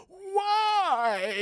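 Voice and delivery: long high-pitched dying cry; hammed-up final wail; mock dying wail. Dying king's last cry.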